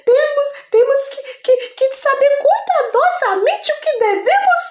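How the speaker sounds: high, loud thrilled wife; excited wife voice. Acted. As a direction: squeal it out, overjoyed. Wife thrilled by the angel's wishes.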